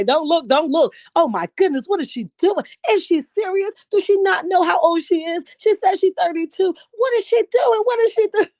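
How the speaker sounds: high, scandalized pearl-clutching parent voice; shocked parent. Retold or imitated. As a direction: clutch your pearls and gasp at her. Imitating shocked parents at party.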